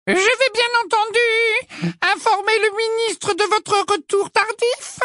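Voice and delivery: very high, slow official voice; prim, menacing official. A narrator's reading. Cold inquisitor warns Hagrid she will inform the minister, prim menace. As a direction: draw it out high with icy politeness. Umbridge coldly threatens to report Hagrid.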